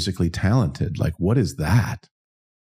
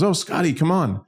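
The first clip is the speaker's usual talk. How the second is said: cheerful call; 9 semitones higher than usual. Retold or imitated. Roadie calls him from bus.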